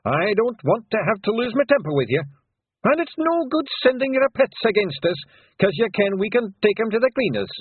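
cocky little brawler squaring up; Rob Anybody. A narrator's reading. Rob threatens Queen.

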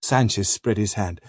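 ordinary delivery, nothing marked

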